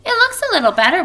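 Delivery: lightly played chirpy doctor; brisk doctor. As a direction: rattle it off like a cheerful doctor. Doctor voiced in read story.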